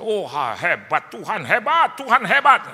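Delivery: imitating an overjoyed nephew; ecstatic praise. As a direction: build to a shrieking God is great. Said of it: "Ponakan istri saya".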